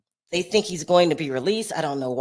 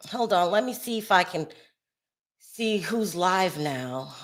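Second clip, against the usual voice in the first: ordinary delivery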